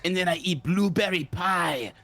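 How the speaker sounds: hammed-up French caricature; mock French accent. Mocking the French with cigarettes and pie, caricature French accent. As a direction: put on a thick, silly French accent. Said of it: "I am French".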